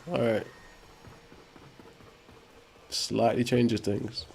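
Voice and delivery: usual speaking voice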